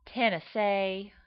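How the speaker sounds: slow Tennessee drawl as a woman; Tennessee woman's accent. Shown as a demonstration. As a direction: draw Tennessee out like a Southern lady. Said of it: "my Tennessee becomes a woman".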